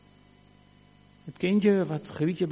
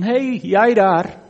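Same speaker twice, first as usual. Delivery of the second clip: loud, drawn-out hail; impersonal calling out; 2 semitones higher, 6 dB louder, slower than usual. Acted. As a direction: call out to some nameless person over there. Onpersoonlijk roepen, zoals God niet doet. (luid iemand aanroepen)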